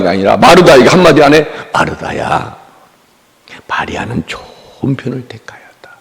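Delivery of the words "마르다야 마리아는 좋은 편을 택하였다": soft unvoiced whisper of Jesus's words; hushed whisper. Jesus gently telling Martha, whispered. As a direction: whisper it gently, as a quiet rebuke.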